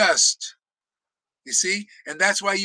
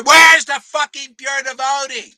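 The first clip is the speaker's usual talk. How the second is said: loud, higher, outraged yell; furious shout; 4 semitones higher, 10 dB louder than usual. Real, not acted. Preacher demanding the pure devotee from the gurus, yelling. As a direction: hurl the question at them.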